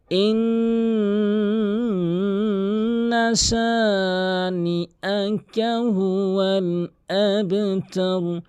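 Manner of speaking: solemn tilawat; Quranic recitation. Shown as a demonstration. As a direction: intone each word long and melodic. Reciting the verses.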